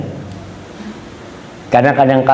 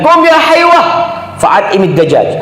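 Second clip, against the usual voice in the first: mother calling; 12 semitones higher than usual. Acted. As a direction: call your son from across the room. Mother calling her scholar son.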